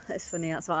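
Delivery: ordinary talk, nothing marked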